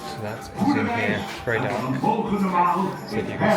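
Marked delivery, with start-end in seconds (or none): none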